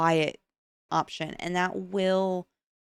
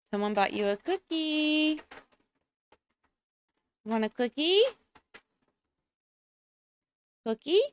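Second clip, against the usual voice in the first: calling a dog; 8 semitones higher than usual. Real, not acted. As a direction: call the dog over, bright and high.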